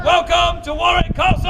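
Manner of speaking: loud, high showman's holler; insistent, full-voiced crowd rouser; rousing shouted welcome. Real, not acted. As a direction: belt it out for a bigger cheer. Repeats welcome, demanding a bigger cheer.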